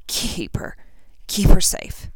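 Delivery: breathy, failing words; dying whisper. Acted. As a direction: force it out with last breath. Dying Harry's request.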